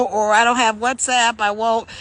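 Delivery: ordinary talk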